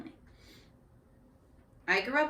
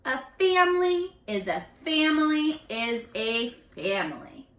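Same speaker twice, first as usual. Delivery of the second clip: expressive picture-book reading; read-aloud voice for young kids; reading to children; 5 dB louder than usual. A narrator's reading. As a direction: carry the class to the story's ending. Teacher reads picture book aloud.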